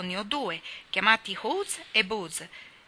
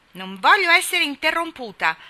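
sharp, much higher and louder retort; cross protest; 9 semitones higher, 9 dB louder than usual. Acted. Nan scolding the boys who interrupted her essay, loudly indignant. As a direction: snap at the rude interrupters. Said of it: "disse Nan, facendo la faccia scura".

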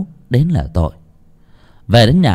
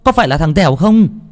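high, startled calling out; alarmed cry; 7 semitones higher than usual. A narrator's reading. Grandmother spots a body by the village gate, alarmed cry. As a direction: cry out in alarm at a sudden sight. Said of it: "nghe bà nội hô như vậy".

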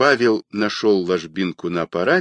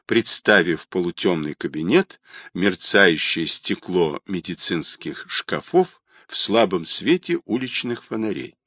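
ordinary delivery

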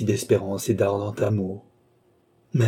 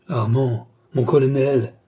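exclaimed; 3 semitones higher, 4 dB louder than usual. A narrator's reading. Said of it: "exclamèrent en même temps".

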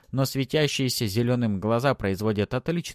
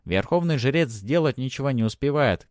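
plain reading voice

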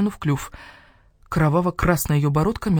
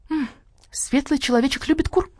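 starling mimicking a hen, higher; 10 semitones higher than usual. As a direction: parrot the hen's words in a hen voice. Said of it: "куриным голосом". (скворец передразнивает курицу)

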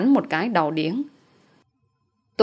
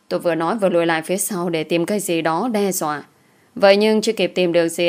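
usual speaking voice

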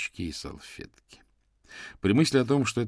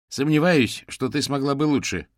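higher, louder defensive snap; snappish retort; 7 semitones higher, 6 dB louder than usual. A narrator's reading. Юрген answers Ханна's dig at his driving, snapping. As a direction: snap back at the criticism. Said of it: "огрызнулся Юрген".

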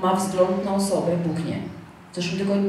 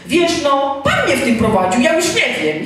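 high, loud, laughing imitation; flustered mock excuse; 6 semitones higher, 5 dB louder than usual. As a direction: parody someone floundering to justify himself. Mimics the flustered man's excuse.

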